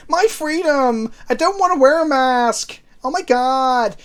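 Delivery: high, loud mock American accent; American impression. Chat asks for a Texas accent, high mock American accent. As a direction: shriek it like an angry American. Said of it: "Now do a Texas accent".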